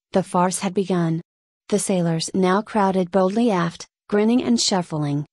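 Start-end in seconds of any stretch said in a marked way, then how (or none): none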